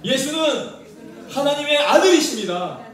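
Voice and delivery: preacher calling out a creed; call to repeat. Shown as a demonstration. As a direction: lead the congregation in a loud confession. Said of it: "자, 따라하십시오".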